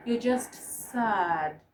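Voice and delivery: slow, downcast "You're just sad"; sad demo. Shown as a demonstration. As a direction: drag it out, sounding sad. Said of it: "this is how you look when you're sad".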